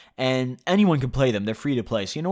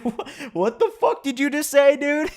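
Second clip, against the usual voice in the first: much higher, laughing disbelief; incredulous Zach; 13 semitones higher, 3 dB louder than usual. Retold or imitated. Zach reacting to the story, in a high, laughing, stunned voice.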